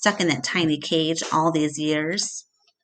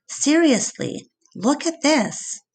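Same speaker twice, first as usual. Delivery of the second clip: put-on higher, insistent girl's voice; urgent young girl; 8 semitones higher than usual. A narrator's reading. Julia urges her dad to look, insistent and higher. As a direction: pull dad's attention, pressing.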